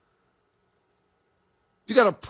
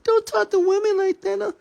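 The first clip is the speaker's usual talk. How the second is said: mocking whiny woman; 14 semitones higher than usual. Mocking a woman who makes excuses to her boss, in a high whining falsetto. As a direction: squeak it out like a self-pitying woman, near tears. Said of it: "'Cause you might say".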